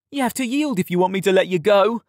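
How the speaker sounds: high, insistent jeer; taunting demand. A narrator's reading. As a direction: lord it over the boy you pinned. Tom demands Yared's surrender.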